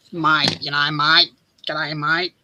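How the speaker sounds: put-on broad Australian accent, lay on the "mate"; mock ocker Australian accent to a friend; broad bogan Aussie. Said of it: "the real bogan ochre".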